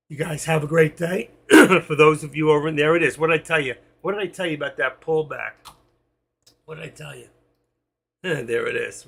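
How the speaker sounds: ordinary talk, nothing marked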